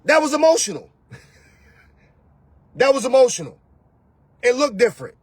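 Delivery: usual speaking voice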